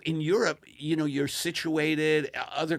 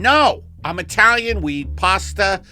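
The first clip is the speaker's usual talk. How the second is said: loud, higher, punchy host pitch; podcast ad read; 5 semitones higher, 8 dB louder than usual. Acted. Host pitching the sponsor's T-shirts to listeners, loud emphatic ad read. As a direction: sell it big to the listeners.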